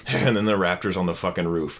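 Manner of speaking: dry, low sarcastic prediction. Retold or imitated. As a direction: say it deadpan, like a joke you expect to come true. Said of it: "like, sarcastically".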